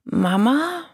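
put-on high-pitched child's questioning voice; drawn-out, rising question; murmured question. A narrator's reading. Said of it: "murmelte Silvania".

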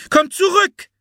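put-on very high, loud girl's shout; calling out. A narrator's reading. Anne calls the fleeing Kuckuck back, high loud call. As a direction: shout for the pet to return. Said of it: "rief Anne".

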